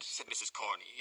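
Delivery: plain reading voice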